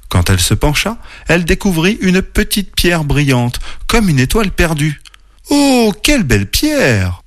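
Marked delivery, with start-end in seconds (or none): 5.5-7.2 high, drawn-out wonder; marvelling. A narrator's reading. Oreille finds a shining stone, marvelling. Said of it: "s'émerveilla-t-elle".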